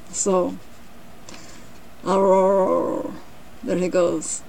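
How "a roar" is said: long, level, half-hearted roar; mock lion roar. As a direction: draw out a pretend roar. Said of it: "he let out an extra long roar".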